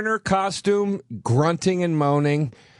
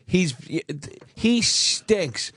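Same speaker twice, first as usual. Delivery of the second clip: ordinary talk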